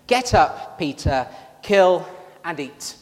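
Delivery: loud, commanding voice from heaven. Acted. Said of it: "this voice comes from, from heaven".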